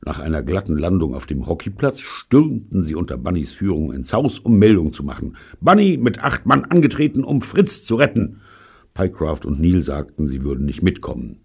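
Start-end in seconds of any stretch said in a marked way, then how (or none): 5.6-8.4 loud, high reporting voice; military report. A narrator's reading. Bunny reports to headquarters for the rescue, military report. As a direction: announce the squad like a soldier on parade. Squad leader reports for duty.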